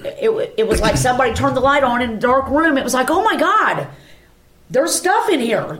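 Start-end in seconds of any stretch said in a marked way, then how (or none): 3.1-5.8 retold astonished outburst, pitched high; higher voice, drawing out the discovery; amazed realization. Telling how a light came on in a dark room, amazed. Sudden sight after light turns on.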